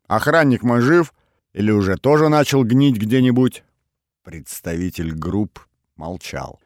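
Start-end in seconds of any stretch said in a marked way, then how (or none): 0.1-3.6 loud, raised cheerful questioning; cheerful needling. A narrator's reading. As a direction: needle them merrily at full voice. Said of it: "весело спросил я". (весёлая громкая подначка)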